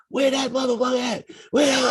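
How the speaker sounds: high yelling mom hunting her son; angry mother. Retold or imitated. Mother storming the hall.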